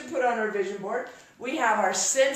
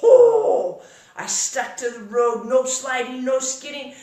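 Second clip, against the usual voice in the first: ordinary talk, nothing marked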